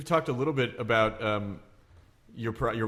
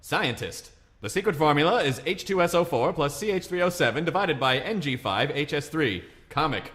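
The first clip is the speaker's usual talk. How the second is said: put-on lilting recital from an old joke manual, building higher to the punchline; mock-formal book reading; 4 semitones higher than usual. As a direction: read it out like a dated instructional text. Reads old joke manual aloud.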